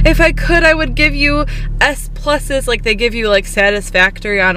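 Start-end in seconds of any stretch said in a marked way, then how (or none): 0.1-2.7 sweet instructor voice. Retold or imitated. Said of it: "she's just like".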